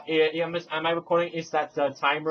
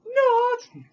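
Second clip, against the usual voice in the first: a squeaky, much higher taunt; mocking squeak; 3 dB louder than usual. Merlee taunts her double with a higher squeaky Not. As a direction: squeal it like a playground tease.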